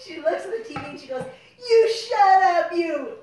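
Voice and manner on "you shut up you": mock mom yelling, loud and slow, louder and higher; furious yell at the TV. Critical mom yells at the preacher on TV, slow furious shout. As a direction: shout it at the television, dragging it out.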